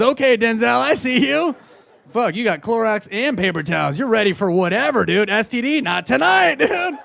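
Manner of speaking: loud, high, escalating hype; hyped cheering. Acted. Comic hails a guy with Clorox and paper towels, hyped cheering. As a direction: cheer him on, building to a high yell.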